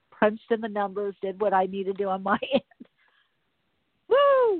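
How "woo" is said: loud, high whoop of relief; triumphant woo as the wireless comes on; joyful whoop. Real, not acted. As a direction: cheer like you just won. Celebrating wireless finally working.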